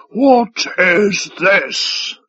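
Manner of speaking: loud, very slow demand. A narrator's reading. Five Tide holding up a flask, demanding. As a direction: draw out each word as a demand. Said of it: "Five Tie demanded".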